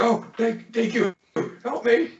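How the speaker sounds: high, squeaky little gnome voice; grateful pleading gnome. Acted. Gnome under the rat begs the rescuer, high and pleading. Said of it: "He's like".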